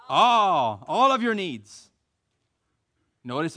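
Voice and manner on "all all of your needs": loud, punchy All; emphatic call back. Real, not acted. Preacher leading the congregation's response, loud and emphatic. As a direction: answer like you truly believe it. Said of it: "Say it with me again, like you believe it".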